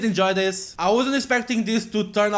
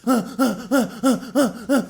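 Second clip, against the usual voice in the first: sharp gasp; 4 semitones higher than usual. Acted. Startled gasp.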